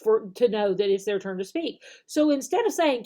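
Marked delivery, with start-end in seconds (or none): none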